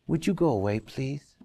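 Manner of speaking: nice on the surface, dismissive underneath; mock polite request. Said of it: "they look at you very nicely".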